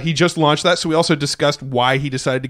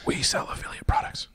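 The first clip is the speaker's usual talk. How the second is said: secretive playful whisper; 9 dB quieter than usual. Pretend. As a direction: hush it like a secret. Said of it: "Why are we whispering?".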